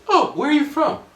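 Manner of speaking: high, bright put-on lady voice; Japanese lady. Japanese lady asking the London guy where he is from, high voice. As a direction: pitch way up, friendly and curious. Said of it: "the conversation went like this".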